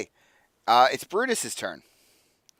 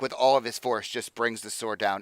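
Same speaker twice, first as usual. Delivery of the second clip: ordinary talk, nothing marked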